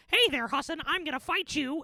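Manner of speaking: imitating a classmate's high squeaky taunt; little Mickey Mouse voice. Short classmate challenges him to fight, imitated Mickey voice. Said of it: "with his little Mickey Mouse voice".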